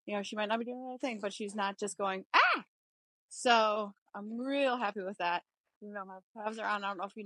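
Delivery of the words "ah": sudden high, loud mock squeal; a short imitated horse shriek; startled squeal. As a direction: yelp like a spooked mare.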